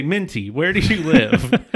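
put-on loud, slow call to a drunk; mock-loud question to a drunk stranger. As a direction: call his name out slowly and loudly. Calling out to drunk guy.

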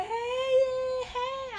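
very high, squeaky sing-song hey; 1 mock fake-friendly greeting. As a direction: play the sugary fake friend. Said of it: "she be like".

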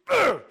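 loud, high frustrated grunt; angry grunt. Retold or imitated. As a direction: let out a burst of rage. Retelling hitting the lift.

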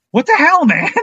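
high-pitched mock outrage; exasperated outburst. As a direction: retell it as an incredulous high yelp. Outraged friend throws hands up.

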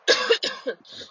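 dry throaty cough; coughing fit. Real, not acted. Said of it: "I'm sorry if I'm coughing a lot".

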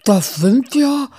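lost for words at the sight; stunned stammer. Acted. Muff sees the rats and falters, stunned. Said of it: "Muff verschlug es die Sprache".

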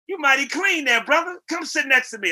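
put-on the star calling a young man over. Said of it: "he goes".

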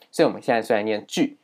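ordinary talk

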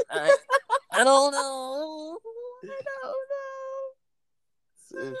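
very high, drawn-out wail; crying voice. Real, not acted. Stuck for a topic, comical crying voice. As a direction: wail it slowly in a squeaky cry. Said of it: "Why is your crying voice so fucking funny?".

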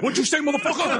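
high, hammed-up indignant challenge; mock angry orderly. Imagined orderly confronting a patient, mock angry voice.

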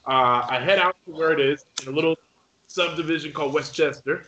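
ordinary delivery

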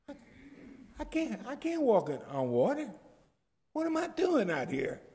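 high, frightened cry of disbelief; panicky Peter. Acted. Peter sinking in the storm, voice high and scared. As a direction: Peter realizing he can't walk on water.

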